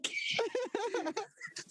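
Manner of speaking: high-pitched laughing fit. Real, not acted. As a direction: crack up laughing. Laughing at being caught.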